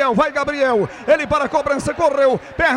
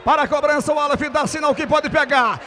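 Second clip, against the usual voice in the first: ordinary talk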